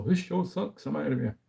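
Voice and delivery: high-pitched mock quitter; mock dismissive quote. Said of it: "for me to go".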